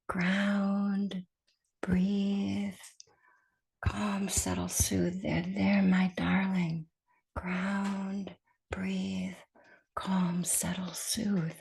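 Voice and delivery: very soft, hushed meditation mantra; soothing mantra. Shown as a demonstration. Teacher sharing a mantra from meditation practice, soft and soothing. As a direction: murmur it softly to calm and settle. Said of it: "I shared a mantra".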